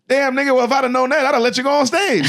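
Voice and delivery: high, laughing through the line; laughing imitation of Chappelle. Chappelle to Derek, regretting the mix-up, high and laughing.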